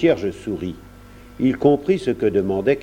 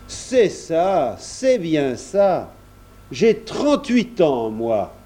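slow, higher-pitched musing; hearty countryman; 6 semitones higher, much slower than usual. A narrator's reading. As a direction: draw it out as the farmer. Kersac, hearty reflection.

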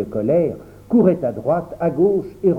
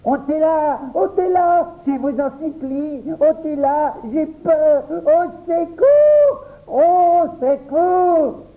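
very high panicked pleading; terrified cries; 12 semitones higher, slower than usual. A narrator's reading. Boy begs others to remove the beast, crying out terrified. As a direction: cry out in panic, stretching the calls for help. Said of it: "Se leva précipitamment et cria".

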